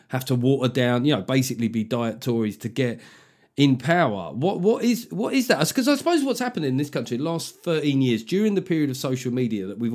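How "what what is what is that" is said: ordinary talk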